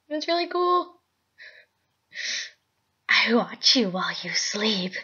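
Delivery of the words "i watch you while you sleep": breathy unvoiced whisper, close and creepy. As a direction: whisper it like a stalker. Creepy filter voice line.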